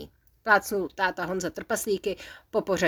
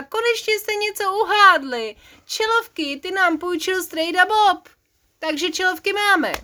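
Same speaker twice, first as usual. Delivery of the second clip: excited; 9 semitones higher, 3 dB louder than usual. Acted. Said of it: "vykřikl nadšeně táta Honza".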